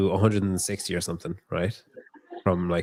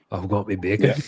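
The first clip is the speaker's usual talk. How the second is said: put-on Scottish accent. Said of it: "What accent was that?".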